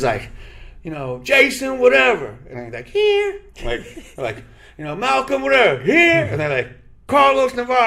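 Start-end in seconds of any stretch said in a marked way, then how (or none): 1.2-2.4 mock coach, loud and high; hammed-up gym coach roll call. Coach calling roll at tryouts. 2.9-3.4 high, loud mock kid reply; mock eager here; answering roll call. 5.0-6.2 coach calling roll. Retold or imitated. 7.1-7.9 high, loud mock coach; coach reading roll. Coach reading his name.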